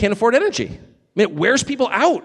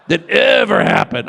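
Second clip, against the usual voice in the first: shouted, slower repeat of the end; loud repeat; 4 semitones higher, 3 dB louder, slower than usual. Shown as a demonstration. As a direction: hammer the words home, louder. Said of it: "That was the craziest thing that ever happened".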